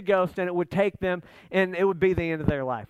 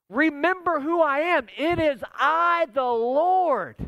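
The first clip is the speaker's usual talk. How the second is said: raised, emphatic preacher's delivery; Jesus rebuking; 6 semitones higher than usual. Acted. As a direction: proclaim it with divine authority, pitched high.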